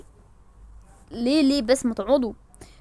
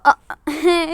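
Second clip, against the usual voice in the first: mock crying; 4 semitones higher, 5 dB louder, slower than usual. Comic distress.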